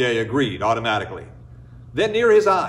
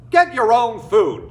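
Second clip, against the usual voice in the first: higher, louder, slow scolding; scolding villagers; 8 semitones higher, 6 dB louder than usual. Acted. People scold the orphan boy, loud and dismissive. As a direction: brush him off sharply. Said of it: "they said".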